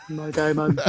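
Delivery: laughing. Real, not acted. Repeating name while laughing.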